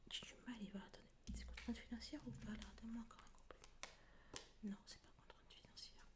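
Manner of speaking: quick, hushed whisper. Real, not acted. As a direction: mutter softly to the deck. Whispering while consulting cards.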